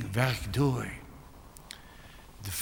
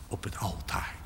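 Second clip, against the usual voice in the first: whispered, much softer; 5 semitones lower, 8 dB quieter than usual. Acted. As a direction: breathe the words at the altar. Hushed close of an appeal.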